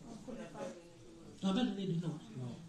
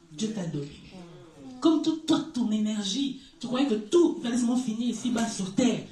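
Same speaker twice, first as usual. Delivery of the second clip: normal talking voice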